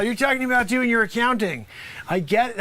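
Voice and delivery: ordinary talk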